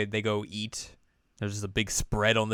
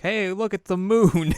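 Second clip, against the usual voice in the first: high, loud, drawn-out wonder; excited exclamation; 10 semitones higher, 7 dB louder, slower than usual. Retold or imitated. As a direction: call out excitedly, stretching the words. Chisato excited about the moon.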